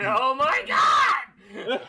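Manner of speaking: loud, slow shout through laughter; laughing shout. Real, not acted. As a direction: crack up and yell it. Cracking up at a joke.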